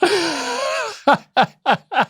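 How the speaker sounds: high squealing laugh; real squeal of laughter at a joke. Real, not acted. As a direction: burst out in a high shriek of laughter. Reacting to the Aussie sentence.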